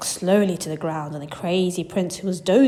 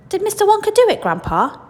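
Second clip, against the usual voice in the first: put-on high, louder eager boy's question; eager child Charlie; 13 semitones higher, 5 dB louder than usual. Boy asking his grandfather, eager child voice. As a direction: ask like an excited little boy.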